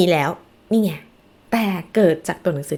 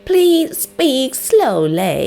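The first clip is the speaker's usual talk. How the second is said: slow model phrase; 6 semitones higher, 6 dB louder, much slower than usual. Shown as a demonstration. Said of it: "ถ้าเจอครูฝรั่งบอก".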